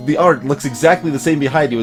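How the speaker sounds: ordinary talk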